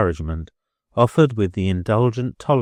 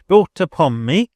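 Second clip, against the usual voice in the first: higher, louder landlady interjection; indignant landlady; 9 semitones higher, 8 dB louder than usual. A narrator's reading. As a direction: cut in sharply as the landlady. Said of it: "stipulated the Billiken expressly".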